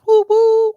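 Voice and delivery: high, loud "woo, woo"; playful whoop. Retold or imitated. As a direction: call out a singsong high whoop. Mimes a playful check-in call.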